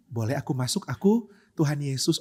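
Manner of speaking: softly asking leave to enter; calm, polite. Acted. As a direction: introduce yourself meekly, almost too gently. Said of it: "contoh orang yang tenang". (tenang dan santun, pelan)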